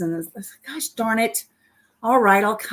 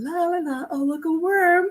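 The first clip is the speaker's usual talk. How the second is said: higher, sing-song clueless fish; lightly voiced fish humming along, then spotting bait; carefree fish; 9 semitones higher than usual. Fish in her story, humming along before biting the doctor's hook, lightly voiced. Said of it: "I'm that fish going".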